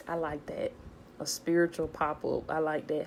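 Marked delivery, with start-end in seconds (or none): none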